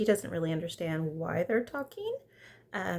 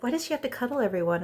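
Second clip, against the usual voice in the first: fast, higher mock whine; quick petulant whine; whiny complaint; 6 semitones higher, 4 dB louder, faster than usual. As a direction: imitate a jealous sulk. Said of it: "He's like".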